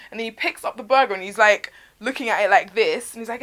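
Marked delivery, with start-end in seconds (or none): none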